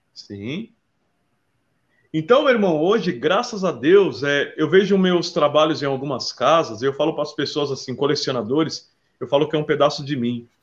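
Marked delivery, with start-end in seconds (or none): none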